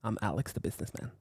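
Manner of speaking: a hint of a smooth businessman introducing himself. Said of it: "This is Alex the businessman talking again".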